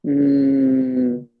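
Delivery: long humming sigh out; audible sigh. Shown as a demonstration. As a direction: let the breath out in an audible hum. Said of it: "so it'll sound like this".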